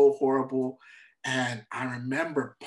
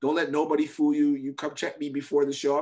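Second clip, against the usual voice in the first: ordinary delivery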